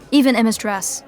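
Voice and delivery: normal talking voice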